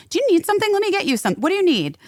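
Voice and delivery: high-pitched, urgent offer of help; eager offer. Retold or imitated. Passenger offering a flustered mother help, eager and high-pitched.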